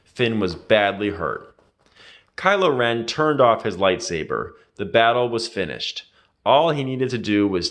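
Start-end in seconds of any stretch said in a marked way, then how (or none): none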